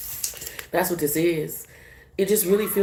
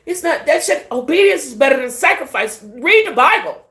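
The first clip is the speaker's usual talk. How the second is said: higher, louder fired-up preaching; fired-up rant; 8 semitones higher, 6 dB louder than usual. Real, not acted. Telling viewers to read the Bible, higher and louder.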